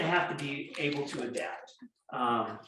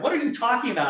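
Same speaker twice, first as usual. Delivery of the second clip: confused cop; 9 semitones higher, 6 dB louder, faster than usual. Retold or imitated. Officer confused by speeder.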